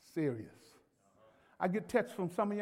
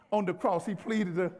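raised, driving sermon delivery; emphatic preaching; 5 semitones higher, 6 dB louder than usual. Real, not acted. Preacher telling church to plead the case, emphatic preaching. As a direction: preach it with mounting force.

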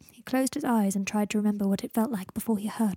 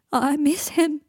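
louder, high grieving cry; sobbing; 6 semitones higher, 6 dB louder, slower than usual. A narrator's reading. Greg grieves for his dead friend, sobbing louder. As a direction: wail it through acted sobs. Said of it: "sobbed Greg".

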